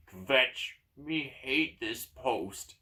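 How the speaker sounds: slow, gruff orc grumble; dragged-out gruff creature voice. Acted. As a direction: grumble it slowly as a surly orc. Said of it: "says a gruff orc voice".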